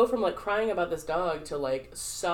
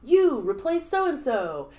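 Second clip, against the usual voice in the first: higher, louder call to freeze; sharp, raised-voice game command; 8 semitones higher, 6 dB louder, slower than usual. Retold or imitated. Improv game leader calling a player in, calling out. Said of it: "and then they say".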